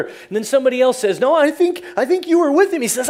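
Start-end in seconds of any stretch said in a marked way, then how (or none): 1.2-2.8 high, loud acted accusation; insistent accusation. Preacher as a bystander accusing Peter, loudly insistent. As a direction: point him out insistently, voice raised.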